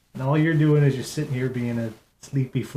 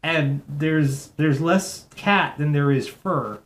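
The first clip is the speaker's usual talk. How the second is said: normal talking voice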